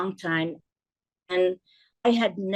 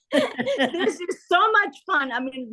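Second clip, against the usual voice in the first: high, drawn-out words through laughter; real laughing; 10 semitones higher, slower than usual. Real, not acted. Guest reliving her cold-call practice with delight, laughing. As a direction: laugh your way through it, pitched right up.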